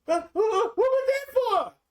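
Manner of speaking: very high, slow, shocked whine; stunned protest. Retold or imitated. Slapped guest protesting in shock, high and slow. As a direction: squeak it out high and slow, stung. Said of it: "she slapped the taste out of your mouth".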